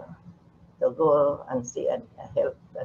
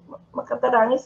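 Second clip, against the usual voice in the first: usual speaking voice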